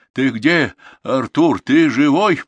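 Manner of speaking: loud, high searching shout; anxious call. A narrator's reading. Grandfather hunts for his grandson after blasts, calling anxiously. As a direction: call out into the dark for him.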